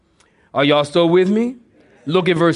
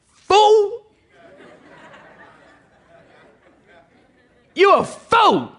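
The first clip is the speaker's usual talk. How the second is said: booming shout; much slower than usual. Shown as a demonstration. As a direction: thunder it down like an angry God. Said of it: "I think Jesus probably said".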